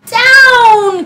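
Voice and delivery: high, loud cry of Down!; witch's cry. Acted. Witch ordering the broom to land, loud high cry. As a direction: call the broom down sharply. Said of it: "cried the witch".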